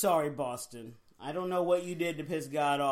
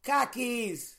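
higher mock Boston "khakis", like "kah-keys"; Boston accent; 6 semitones higher than usual. Co-host trying out the meme's word, Boston accent. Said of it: "to people in Boston, it's their khakis".

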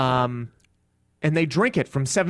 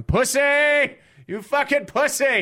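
mock heckler yell; heckling yell; 11 semitones higher, slower than usual. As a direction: heckle like a furious fan. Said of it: "You can hear me at the back of the room going".

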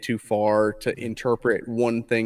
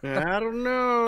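much higher, slow sing-song; coy sing-song; 12 semitones higher, slower than usual. Pretend. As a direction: drag it out coyly, very high. Coy teasing repeat.

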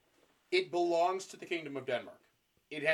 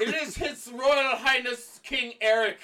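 high, loud, slow proclamation; mock royal herald; 7 semitones higher, 6 dB louder, slower than usual. Introducing King Eric of Pomerania, mock herald. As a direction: announce the king with mock pomp. Announcing the king's entrance.

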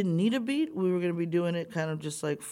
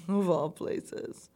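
ordinary talk

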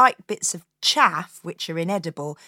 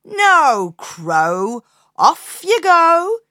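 loud, high no, slow off you go; firm refusal; 7 semitones higher, 10 dB louder, much slower than usual. Acted. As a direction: send the crow away firmly. Woodcutter refuses the crow.

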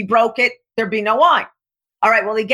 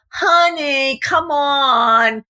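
higher, wheedling "Honey, come on."; pleading honey; 4 semitones higher, much slower than usual. Retold or imitated. Begging partner to let a broken promise slide, wheedling. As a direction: coax the partner sweetly. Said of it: "you go".

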